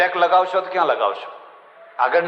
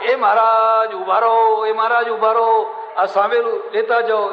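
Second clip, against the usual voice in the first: drawn-out call, higher and louder, slow; calling after him; 5 semitones higher, 3 dB louder than usual. Retold or imitated. Husband running after the holy man with a pestle, slow loud call. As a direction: shout after the fleeing holy man to stop.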